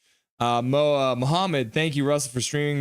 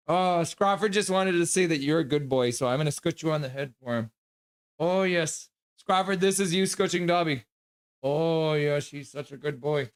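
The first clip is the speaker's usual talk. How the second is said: higher, cooing praise for the dog; 4 semitones higher than usual. Real, not acted. Streamer petting his dog for a viewer, cooing. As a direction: coo over him like a puppy.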